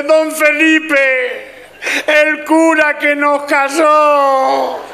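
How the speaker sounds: very high, drawn-out comic wail; mujer llorando a gritos. Acted. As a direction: howl each word slowly as the weeping wife. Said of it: "la que está llorando es la mujer". (llanto agudo, alargado y teatral)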